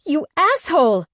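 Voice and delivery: high, loud yell; screamed insult. A narrator's reading. Heroine screaming while carried over his shoulder. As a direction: scream it at him.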